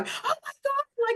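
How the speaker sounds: squealed high, drawn out in mock delight; excited squeal. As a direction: squeal it like you just can't believe it. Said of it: "screaming at each other going".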